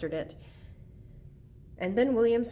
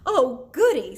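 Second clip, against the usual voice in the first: loud, high burst of delight; gleeful; 5 semitones higher, 12 dB louder than usual. Acted. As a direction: squeal it with eager glee. Tootie delighted at a poetry reading.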